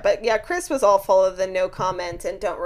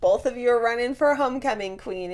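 usual speaking voice

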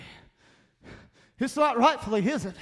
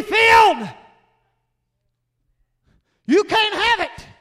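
loud, high preaching cry; a preacher shouting down the devil; fiery preaching; 6 semitones higher, 8 dB louder than usual. Acted. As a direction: build the congregation up with force. Preaches at full force.

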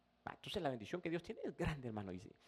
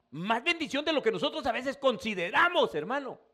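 much louder and higher preaching; preaching climax; 9 semitones higher, 9 dB louder than usual. Real, not acted. Preacher exhorting the brothers about God's blessing, loud. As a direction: lift the congregation with it.

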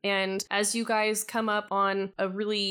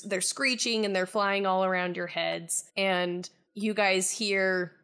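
normal talking voice

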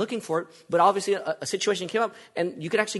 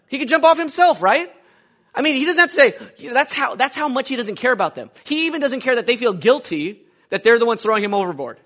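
higher and louder, driving every line home; fiery preaching; 7 semitones higher, 10 dB louder than usual. Acted. Preacher thundering to the congregation about Jonah's selfishness. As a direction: preach it hard, building conviction.

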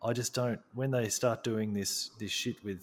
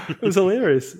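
laughing; 7 semitones higher, 8 dB louder than usual. Real, not acted. Laughing at the story.

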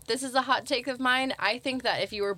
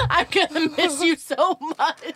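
tearful goodbye; 8 semitones higher, 7 dB louder than usual. Pretend. Goodbye, laughing and higher.

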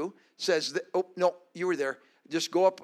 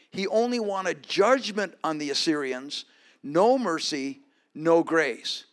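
raised preaching voice, building; 2 semitones higher, 5 dB louder than usual. Acted. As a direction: preach it out with force. Preaching at full force.

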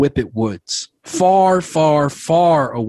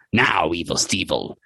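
low stone voice; 6 semitones lower than usual. Acted. The magic stone speaking to the players, low and eerie. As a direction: rumble it like a talking stone.

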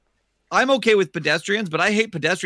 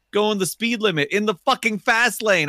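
usual speaking voice

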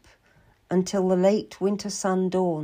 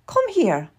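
high summons to a servant; calling out; 10 semitones higher, 4 dB louder than usual. Acted. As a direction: call her in from the door, high and commanding. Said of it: "went to the door and called".

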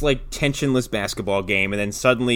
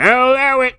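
referee's ruling; 12 semitones higher, 4 dB louder than usual. Retold or imitated. Referee waving through blatant fouls, high mock ruling. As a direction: call it out high like a lenient ref.

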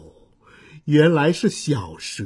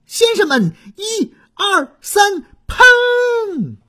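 much higher, louder countdown; shouted commands; 4 dB louder, slower than usual. Acted. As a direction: count them in and yell fire. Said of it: "小蛇在菲菲娜头顶上指挥着".

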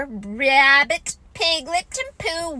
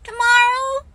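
put-on high, eager child's question; piping hopeful youngster; 11 semitones higher, 6 dB louder than usual. A narrator's reading. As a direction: ask it hopefully in a little voice. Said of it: "said Roo hopefully".

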